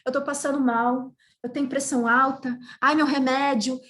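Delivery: high, hammed-up whine about her heart; lady mimicked clutching her chest, whining; mock fainting drama. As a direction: overplay a fake health scare, high-pitched. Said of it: "Aí ela".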